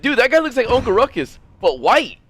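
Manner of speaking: high, loud amused exclamation; excited quote. Retold or imitated. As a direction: blurt it out all wound up. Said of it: "I'd be like".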